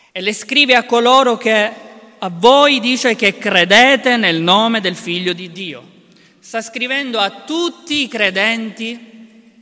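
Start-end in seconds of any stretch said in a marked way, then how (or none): 0.1-5.8 preaching at full force. Acted. Preacher exhorts the brothers.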